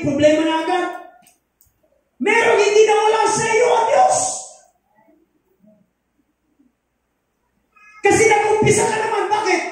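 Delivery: fiery preacher hammering the questions; preaching at full force. Real, not acted. Preacher shouting to congregation.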